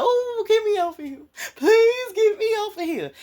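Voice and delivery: high, wailing mock scream of panic; terrified screaming. Frightened rider begging to leave the roller coaster, panicked scream. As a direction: plead at the top of your voice to get off. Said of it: "other people could be yelling and screaming like".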